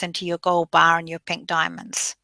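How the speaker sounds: normal talking voice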